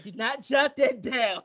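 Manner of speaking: high, slow, pleading half cry; pleading to therapist. Retold or imitated. To his therapist, a slow high pleading cry. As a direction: beg her not to write it down. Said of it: "I said".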